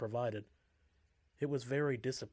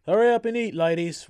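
loud barked order; shouting; 10 semitones higher, 15 dB louder than usual. A narrator's reading. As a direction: yell it across the hall. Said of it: "one of the guards shouted".

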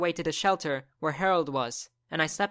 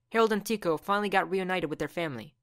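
usual speaking voice

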